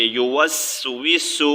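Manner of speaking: یو وس وس drawn out slowly, syllable by syllable; slow spelling. Shown as a demonstration. Teacher slowly reads the word for students. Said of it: "فی الحال پر پڑھیں گے".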